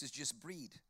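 "bread" said as "breed"; exaggerated New Zealand "bread"; Kiwi vowels. Shown as a demonstration. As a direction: raise the e in "bread" toward ee, Kiwi style. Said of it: "they do their vowels in reverse".

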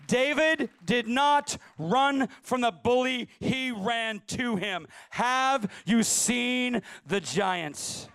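loud and very slow, word by word; slow booming preacher climax; slow emphatic climax. Acted. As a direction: drag every word out for weight. Sermon climax, slowed.

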